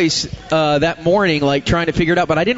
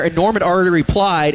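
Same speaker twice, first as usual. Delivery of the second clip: ordinary delivery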